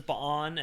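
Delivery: "Ba'an" in two syllables, buh-AHN; first variant pronunciation. Shown as a demonstration. Listing how different people say it, two-syllable variant. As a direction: break "Ba'an" between the two A's. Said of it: "each person pronounce it differently".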